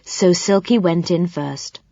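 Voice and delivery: ordinary delivery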